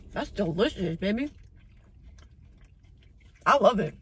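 chewing while talking; speaking through a bite; mouth full of pie. Real, not acted. Seen 0: Man bites the pie from 0.6 and chews while speaking.

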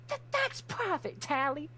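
huffy and caught out; embarrassed defensive. Acted. Crimson defending her journal to Tally, flustered and high.

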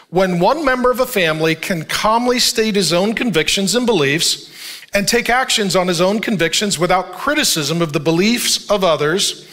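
usual speaking voice

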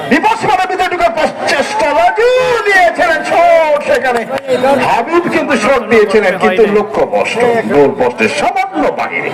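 high-pitched excited commentary; frantic football commentary. Real, not acted. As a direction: voice shoots up as the shot comes. (উত্তেজিত football ধারাভাষ্য)

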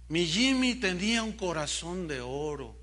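normal talking voice

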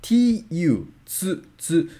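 TSU said with a clear ts, tsu, tsu; careful pronunciation. Shown as a demonstration. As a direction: model the Japanese sound tsu slowly. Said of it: "tsu sound is more natural".

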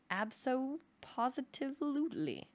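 high, slow, stretched-out certainty; hammed-up total assurance; emphatic confirmation. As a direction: draw out every part of the word. Storyteller confirms with emphatic invented word.

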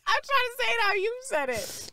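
high-pitched protest. Real, not acted. Defending her mimicry amid laughter.

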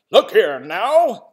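loud, slow and stern; stern call. Acted. North-going Zax confronts the south-going one, loud and stern. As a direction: plant your feet and bark it slowly. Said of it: "the north-going Zack said".

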